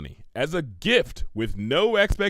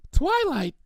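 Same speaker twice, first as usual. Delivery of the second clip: much higher excited pony voice; Pinkie's voice; 3 dB louder than usual. Acted. Pinkie hears Twilight's savings news, high and excited. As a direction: squeal her friend's name.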